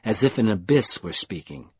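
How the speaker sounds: plain reading voice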